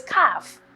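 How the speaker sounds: CALF with no L sound; silent L. Shown as a demonstration. Spelling teacher correcting a mistake, CALF with silent L. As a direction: drop the L in CALF. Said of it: "the L is silent".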